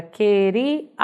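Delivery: "queríamos" broken up as que-ri, a, mos; separated syllables. Shown as a demonstration. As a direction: pause between the parts of "queríamos". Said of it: "numa separação aí você tem". ("queríamos" dividido em sílabas, com pausas)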